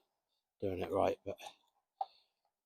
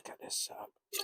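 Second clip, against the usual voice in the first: hushed whisper while setting up; 4 dB quieter than usual. Real, not acted. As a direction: whisper it so nobody hears. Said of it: "quietly as I can".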